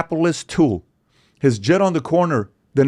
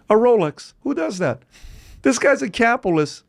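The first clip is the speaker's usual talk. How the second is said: amazed; 11 semitones higher than usual. Real, not acted. Marvels at a lavish birthday gift.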